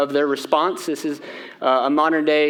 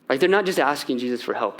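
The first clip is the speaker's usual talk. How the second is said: usual speaking voice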